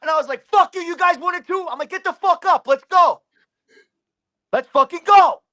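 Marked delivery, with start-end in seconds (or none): 0.5-1.7 loud, high re-enacted taunt; acted-out fighting shout; angry challenge. Retelling challenge to the others. 1.9-5.4 loud, high fighting call; acted-out aggressive yell; shouted challenge.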